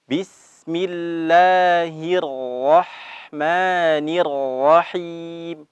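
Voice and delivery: slow, drawn-out recitation of Bismillahirrahmanirrahim; Quranic recitation. Shown as a demonstration. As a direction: chant it slowly with tajwid. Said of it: "Baik, kita ulang". (bacaan tartil pelan)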